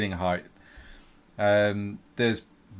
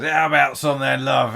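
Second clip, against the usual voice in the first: leering sailor; 4 semitones higher, 8 dB louder than usual. Acted. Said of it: "he grabs a handful of her breasts and goes".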